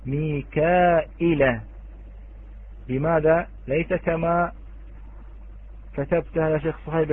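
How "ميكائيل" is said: ميكائيل drawn out slowly, hamza then a short i with no long ya; Warsh pronunciation. Shown as a demonstration. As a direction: stretch the vowel before the hamza in ميكائيل, six counts. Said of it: "قرأها ورش". (ميكائيل ممدودة ببطء بهمزة مكسورة من غير ياء)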